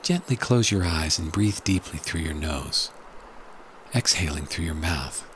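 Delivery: hushed and calm, guiding relaxation. Acted. Leads a guided meditation.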